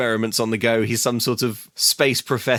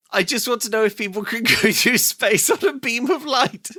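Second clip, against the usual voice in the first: incredulous higher parroting with a laugh; higher mock repetition of Zoe; 8 semitones higher than usual. Co-host repeating Zoe's question in disbelief, higher. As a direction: repeat her line in disbelief, pitched up. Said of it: "I'm sorry, what?".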